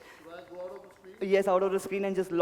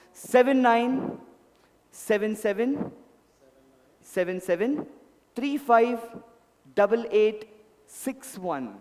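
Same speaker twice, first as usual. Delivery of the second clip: slow dictation; 2 semitones higher, slower than usual. Real, not acted. Dictating number slowly.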